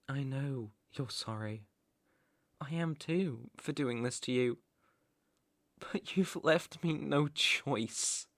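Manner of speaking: hushed, low regret; soft apology. Acted. Captor apologises for punishing captive, soft and regretful. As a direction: soften right down as if sorry.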